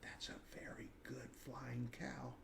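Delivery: a secret whisper meant only for him. A narrator's reading. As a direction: whisper it just for his ears alone. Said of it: "She whispered in his ears alone".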